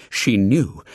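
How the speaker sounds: ordinary delivery, nothing marked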